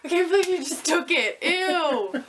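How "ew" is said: disgusted laugh, louder and higher. Real, not acted. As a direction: squeal it through a laugh. Laughing disgust at gum taken.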